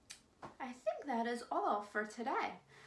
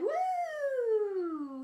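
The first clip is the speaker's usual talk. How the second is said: loud, high, sliding "woo"; mouse's whoop; 12 semitones higher, 7 dB louder than usual. Pretend. Host voicing the mouse in the rhyme, gleeful high whoop. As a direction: whoop high, then slide down. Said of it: "and the mouse said".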